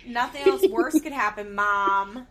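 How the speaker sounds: put-on loud, deadpan teen complaint; mock monotone with a long, level last word; monotone Janelle. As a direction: imitate an unmoved teenager, loudly.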